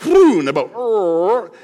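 loud hammed-up roar sound; mock roar of the boat. Preacher voicing the boat straining under fish, a loud mock roar.

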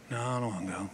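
put-on low, soft mumbled kid reply; sulky kid. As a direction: mumble it like a kid who won't go. Sulky kid answering parent.

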